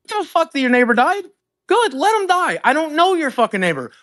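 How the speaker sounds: high-pitched mock yell, all fired up; retold angry outburst. Streamer retelling how he snapped at the caller, high angry yell. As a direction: spit it back at him, hot and high.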